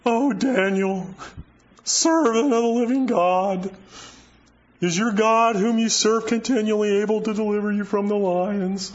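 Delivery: a preacher's mild take on a king lamenting at the den; lamentable cry. Retold or imitated. Preacher voicing the worried king calling into the den, mildly lamenting. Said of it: "with a lamentable voice".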